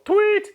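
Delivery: high, sharp vocal "tweet" like a whistle blast; quick shrill-free whistle chirp; whistle imitation. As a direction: mimic the coach's whistle with your voice. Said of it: "Blow the whistle".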